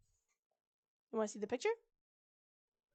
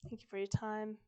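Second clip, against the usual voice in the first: ordinary delivery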